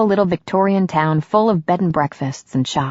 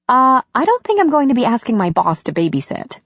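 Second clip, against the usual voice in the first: higher-pitched woman, lightly voiced; a touch of wry amusement, pitched up; Katie, amused; 7 semitones higher than usual. A narrator's reading. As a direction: brush off the idea playfully. Katie answers Connor, amused.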